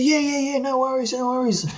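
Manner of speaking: high-pitched, obliging English caller, lightly imitated; Pommy accent. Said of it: "it's a Pommy accent".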